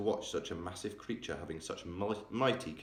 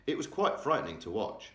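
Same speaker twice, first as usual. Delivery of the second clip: plain reading voice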